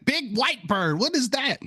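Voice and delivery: put-on high-pitched childlike wonder; excited little kid. Speaker as a child spotting a bird, excited. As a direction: point it out like an amazed kid. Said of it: "as a little kid".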